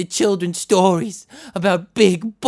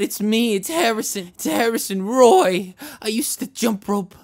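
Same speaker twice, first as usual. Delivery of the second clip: usual speaking voice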